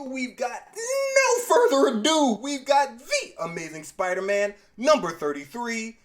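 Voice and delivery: loud, high ringmaster-style build; hyped-up announcement. Pretend. Host introducing the main comic, loud showman's hype. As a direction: launch in big, stretching the first phrase.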